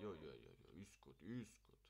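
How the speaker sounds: usual speaking voice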